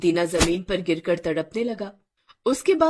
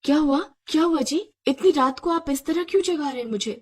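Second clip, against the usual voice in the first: higher, flustered questioning; quick confused questions on being woken; roused wife, puzzled and put out; startled awake; 6 semitones higher, faster than usual. Acted. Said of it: "हड़बड़ाकर उठ बैठी".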